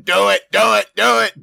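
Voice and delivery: loud, high, rapid-fire urging; eager egging on. Acted. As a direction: chant it like a gleeful instigator. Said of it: "he'd be going".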